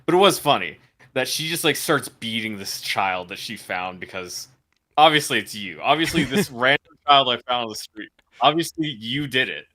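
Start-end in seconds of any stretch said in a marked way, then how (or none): 5.0-8.1 mock accusation. Mocking character's accusation.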